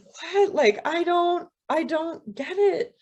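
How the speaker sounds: mild bewilderment. Acted. Woman confused by crow's answer.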